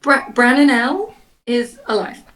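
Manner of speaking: slow, spaced-out careful announcement; big news repeated word by word. Acted. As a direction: say it again slowly, haltingly.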